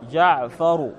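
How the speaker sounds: ordinary talk, nothing marked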